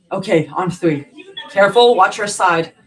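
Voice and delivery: loud crew instructions; firm loud commands; calling out. A narrator's reading. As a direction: call out to the team. Paramedics lifting stretcher.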